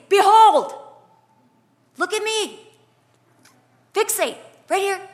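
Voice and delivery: high, forceful call for attention; commanding call. Acted. God to panicking people, commanding call. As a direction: cut through panic with a loud command. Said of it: "God has to say".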